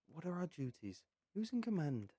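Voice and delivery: loud frustrated questions; frustrated demand. Acted. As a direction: push hard for answers, fed up. Frustrated recruit demands answers.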